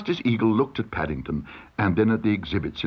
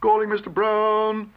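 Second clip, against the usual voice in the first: court usher calling out; 12 semitones higher than usual. A narrator's reading. Usher summons Mister Brown to court, calling out. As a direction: sing it out on one held high note. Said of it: "he heard his name being called".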